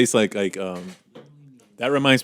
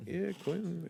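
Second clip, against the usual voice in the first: bored quiet reply; 8 semitones higher, 16 dB quieter, slower than usual. Retold or imitated. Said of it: "they're just like".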